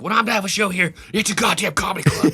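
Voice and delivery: high-pitched mock rage; insist, imitating a furious promoter; losing it. Promoter argues back at the heckler, imitated outrage. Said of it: "he just loses it on this guy".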